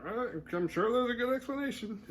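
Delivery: high put-on peacemaker voice; high-pitched mock reassurance; placating. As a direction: mimic someone trying to smooth things over. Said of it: "trying to play, like".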